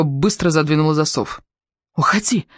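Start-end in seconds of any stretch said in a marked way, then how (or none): 2.0-2.5 sharp, higher-pitched hiss. A narrator's reading. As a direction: hiss the order to leave. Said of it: "прошипела она".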